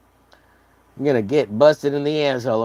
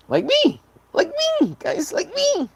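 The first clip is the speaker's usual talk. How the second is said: high squeaky "like me". Pretend. Self-mocking call to chat.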